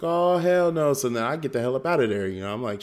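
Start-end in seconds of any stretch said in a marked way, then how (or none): 0.0-0.9 high, loud comic recoil; freaked-out refusal. Retold or imitated. Dad hearing "Nothing" from his son, comically alarmed. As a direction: exclaim it in mock alarm.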